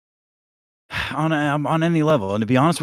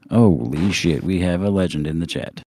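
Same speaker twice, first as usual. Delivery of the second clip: podcaster voice; 4 semitones lower, 4 dB louder than usual. Pretend. Still in podcaster voice.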